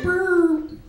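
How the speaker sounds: high, loud siren whoop; recorded cop message starting with a loud siren whoop; police siren. Pretend. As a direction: mimic a cop car siren. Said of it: "They'll be like".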